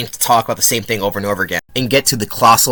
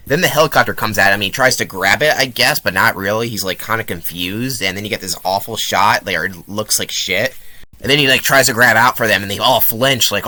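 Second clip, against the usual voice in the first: usual speaking voice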